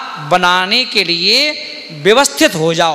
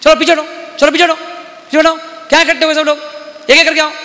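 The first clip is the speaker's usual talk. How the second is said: shouting; 6 semitones higher, faster than usual. Retold or imitated. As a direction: bark orders at the crowd to step back. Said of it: "जोर जोर से चिल्लाया".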